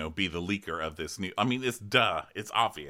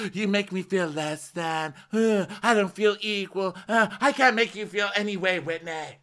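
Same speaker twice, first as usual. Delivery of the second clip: imitating Lisa; 9 semitones higher than usual. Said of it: "And Lisa's like".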